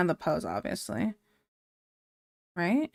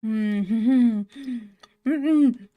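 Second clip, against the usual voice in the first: humming through a mouthful; laughing with mouth full; 2 semitones higher, 3 dB louder than usual. Real, not acted. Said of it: "Okay, I'm good, I'm sorry".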